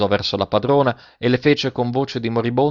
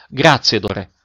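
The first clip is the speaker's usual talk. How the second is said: ordinary delivery, nothing marked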